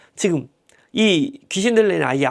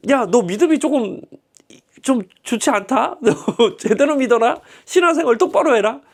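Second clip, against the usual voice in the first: mock-scold the doubting father; scolding Jesus; 5 semitones higher than usual. Jesus scolding again, laughing. (믿음 없는 아버지를 높고 크게 꾸짖는 예수 흉내)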